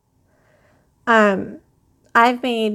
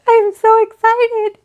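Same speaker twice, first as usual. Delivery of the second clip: much higher and louder, squeaky with real joy; squealing with excitement; 11 semitones higher, 5 dB louder than usual. Real, not acted. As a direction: burst out almost crying with happiness.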